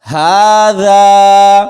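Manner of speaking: ھذا very slow and loud, both aa vowels held; stretched madd. Shown as a demonstration. Teacher modelling the word, long stretched recitation. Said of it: "کھینچ کر پڑھیں گے".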